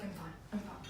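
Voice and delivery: mumbled deflection. Retold or imitated. Reluctant boyfriend deflecting.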